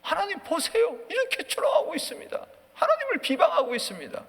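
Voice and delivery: high, strained appeal to God; acted desperate plea; pleading prayer. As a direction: lay out a grievance before God. Acts king's plea to God.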